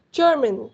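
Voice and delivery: "German" stressed on GER, falling after it; first-syllable stress. Shown as a demonstration. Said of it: "First stress, okay?".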